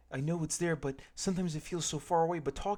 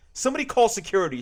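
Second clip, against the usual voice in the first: higher, loud, fast alarm call; shouted; 8 semitones higher, 9 dB louder, faster than usual. Acted. Sharon calling security on George, loud fast shout. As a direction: bark it out for the guards. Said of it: "Sharon shouted".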